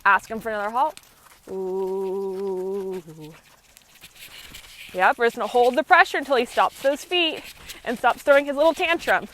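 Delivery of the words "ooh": low, soft drawn-out ooh to the horse; a calming voice cue for the horse to stop; soothing whoa cue. Real, not acted. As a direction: ease him to a halt with a low ooh. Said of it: "I always lead with the voice".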